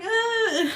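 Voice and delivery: high, loud "ah"; mock breakdown wail. Owner kissing rescued cat, mock wail. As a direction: imitate an overwrought cry while kissing a pet. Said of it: "had, like, a mini breakdown".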